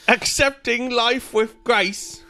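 high, slow, hammed-up British accent; terrible British accent. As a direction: do a bad Mary Poppins-era British voice. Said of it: "It's a terrible accent that I just did".

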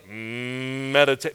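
Meditate, very slow and drawn out. Shown as a demonstration. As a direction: stretch each syllable of Meditate long and slow. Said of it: "say that with me".

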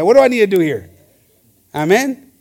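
ordinary delivery

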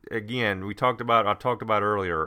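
usual speaking voice

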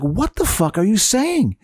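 high, slow, incredulous retort; retold exasperated bafflement, stretched out; baffled. Retold baffled reaction, slower repeat.

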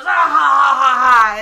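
loud, shouted evil laugh; villain laugh. Retold or imitated. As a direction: boom out a gloating villain laugh. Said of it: "He just goes".

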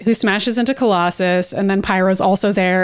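normal talking voice

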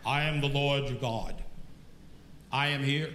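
low, measured voice of the Lord; voice of God. Acted. God answers the man on the cliff, deep divine voice. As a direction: speak as God, deep and unhurried. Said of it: "he heard this voice".

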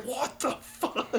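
high, slow, drawn-out imitated panic; imitating a friend yelling on a roller coaster; mock scream. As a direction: squeal it like a terrified rider. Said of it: "he just screamed".

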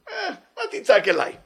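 mock-rude God answering; impolite retort. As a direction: toss it back rudely, half laughing. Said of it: "God is acting not polite".

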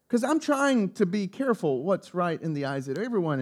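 usual speaking voice

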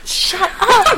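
high, laughing shut up; laughing disbelief. Real, not acted. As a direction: squeal it in delight. Delighted disbelief at right answer.

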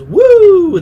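high loud woo call; Ric Flair woo. Retold or imitated. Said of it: "it goes".

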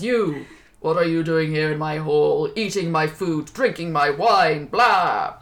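loud, slow, affronted hedgehog voice; indignant hedgehog. Acted. Hedgehog scolding the king for eating his feast, loud and indignant. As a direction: demand answers from the trespassing king.

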